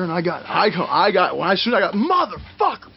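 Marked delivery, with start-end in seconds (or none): 1.9-2.9 high-pitched mock rage; retold flipping out. Wrestler punching walls, retold furious outburst. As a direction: curse in a reenacted fit. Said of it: "I'm flipping out".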